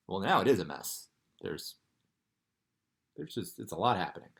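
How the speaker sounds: plain reading voice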